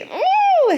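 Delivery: sudden high-pitched ooh; high excited squeal. Real, not acted. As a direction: squeal with delight.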